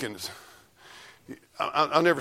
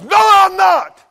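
louder, higher, slow hammering sermon shout; full force preaching; 9 semitones higher, 7 dB louder, slower than usual. Acted. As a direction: thunder it from the pulpit.

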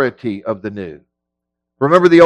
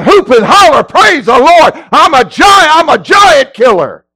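high, loud mock revival shouting; hammed-up triumphant whooping; whoop and holler; 8 semitones higher, 5 dB louder than usual. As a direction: imitate an overexcited believer hollering praise. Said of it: "you're about going".

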